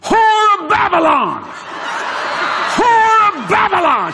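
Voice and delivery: high, drawn-out preacher's shout; thundering denunciation. Retold or imitated. Pointing at the woman on the plane, shouted denunciation. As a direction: cry it out slowly like a prophet's accusation.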